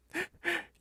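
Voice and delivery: loud high squealing laugh; burst of laughter. Real, not acted. Teasing friend on phone. (높고 크게 터지는 웃음)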